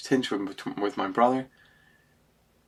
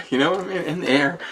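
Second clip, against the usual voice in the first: real laugh breaking into higher speech; 6 semitones higher than usual. Real, not acted. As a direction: talk through a delighted laugh. Laughs, recalling niece's leaping hug.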